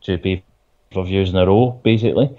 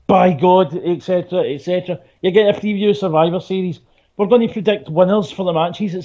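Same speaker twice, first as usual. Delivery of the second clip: high, hyped show preview; hype announcer; 8 semitones higher than usual. Acted.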